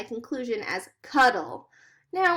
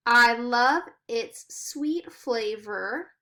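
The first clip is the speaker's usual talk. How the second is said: slow poem reading; much slower than usual. Shown as a demonstration. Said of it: "Next, he has cold and yummy".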